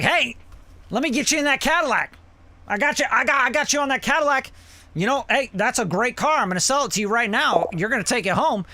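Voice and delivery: high, fast, pushy salesman pitch; used car salesman. Retold or imitated. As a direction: sell it hard like a sleazy car dealer. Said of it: "a used car salesman".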